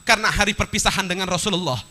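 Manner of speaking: urgent preacher's storytelling; impassioned preaching. Acted. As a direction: press the story on the congregation. Preacher raises voice for climax.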